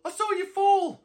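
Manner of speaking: very high, strained voice; high-pitched astonishment at the reunion; disbelieving friend. Acted. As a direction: insist in shocked disbelief. Acted reunion with someone thought lost.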